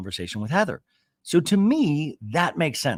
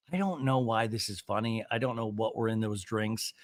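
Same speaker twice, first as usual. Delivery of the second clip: ordinary talk, nothing marked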